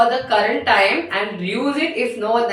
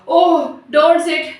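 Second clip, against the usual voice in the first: higher, louder pained moan; 7 semitones higher, 5 dB louder than usual. A narrator's reading. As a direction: moan a protest as if hurting. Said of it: "Tom moaned out".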